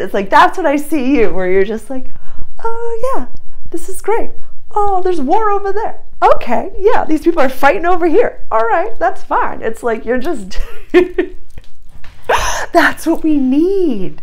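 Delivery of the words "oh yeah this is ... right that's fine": breezy, much higher voice, unfazed by war; carefree unbothered. Pretend. Acting out the viewer shrugging off chaos, breezy and high. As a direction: chirp it like nothing's wrong.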